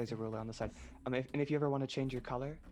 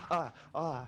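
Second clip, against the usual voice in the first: unconvincing; 2 semitones higher, 5 dB louder than usual. Acted. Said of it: "your statement is utterly unconvincing".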